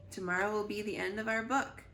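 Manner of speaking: ordinary delivery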